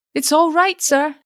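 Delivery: high, buoyant reassurance; breezily confident and high; buoyant assurance. A narrator's reading. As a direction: reassure cheerily, voice pitched right up. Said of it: "he assured the wrathful father buoyantly".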